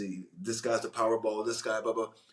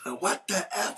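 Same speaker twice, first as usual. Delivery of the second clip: high mock-exasperated outburst, pitched way up; imitating Gary Oldman; 10 semitones higher than usual. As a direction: throw up your hands in a high, fed-up voice. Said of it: "He goes".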